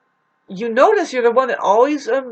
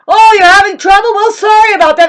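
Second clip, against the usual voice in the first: loud, high sarcastic mockery; shrill fake sympathy, thrown back loud; mock sarcasm; 11 semitones higher, 13 dB louder than usual. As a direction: sneer it out as a fake apology. Mocking uncaring people.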